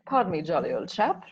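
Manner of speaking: put-on old-fashioned British; British accent. Shown as a demonstration. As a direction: play the jolly British gent. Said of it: "like, a British accent".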